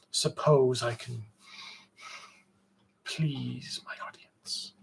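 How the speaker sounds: mock stage whisper, barely voiced; whispered aside. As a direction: whisper it to the audience as a playful aside. Playful aside to chat viewers.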